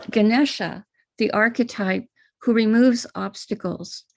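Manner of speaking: ordinary delivery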